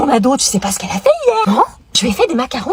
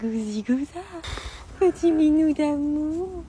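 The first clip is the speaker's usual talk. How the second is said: cooing to a cat; 9 dB quieter, slower than usual. Real, not acted. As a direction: melt over the kitten.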